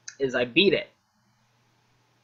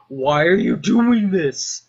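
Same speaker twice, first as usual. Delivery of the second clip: louder, higher, drawn-out protest; slow the question into a raised wail; exasperated yell; 4 semitones higher, 6 dB louder, slower than usual. Retold or imitated. Scientist protests Doom Guy smashing the equipment, exasperated yell.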